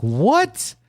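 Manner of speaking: high shocked what; surprised. Retold or imitated. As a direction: react in disbelief. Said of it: "I was like".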